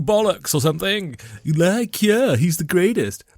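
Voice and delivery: hammed-up awe with stretched words; gushing listeners. Ben imitating you lot praising his writing, gushing. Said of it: "this is you lot again".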